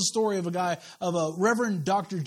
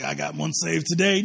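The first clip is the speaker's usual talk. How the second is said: mock boastful believer; 5 semitones lower than usual.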